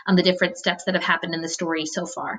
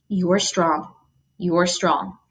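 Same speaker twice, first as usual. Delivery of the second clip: echoing memory; much slower than usual. Acted. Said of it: "her words echoed through his mind".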